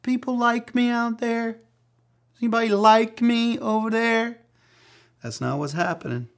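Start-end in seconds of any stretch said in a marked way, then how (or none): 0.0-4.4 high, needy voice fishing for approval; mock-insecure plea, much higher and louder. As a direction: whine it like someone begging to be liked. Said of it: "he's not being insecure".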